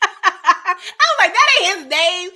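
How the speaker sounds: laughing through the words. Real, not acted. Laughing at herself over a wrong name. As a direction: laugh it out at your own mix-up.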